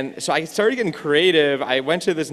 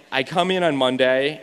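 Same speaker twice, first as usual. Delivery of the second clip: ordinary talk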